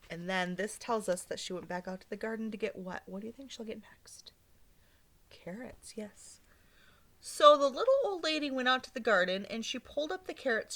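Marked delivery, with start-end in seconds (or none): 3.1-6.4 much softer whispered prompt. Real, not acted. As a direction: hush and invite a guess. Teacher prompts children.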